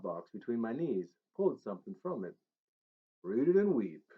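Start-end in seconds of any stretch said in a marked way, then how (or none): 3.2-4.0 [name] declaring. Acted. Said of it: "[name] declared".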